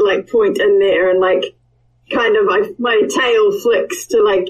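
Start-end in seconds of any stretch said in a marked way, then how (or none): none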